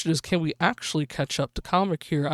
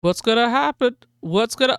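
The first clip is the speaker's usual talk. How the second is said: excited; 5 semitones higher, 4 dB louder than usual. Real, not acted. Tense race finish.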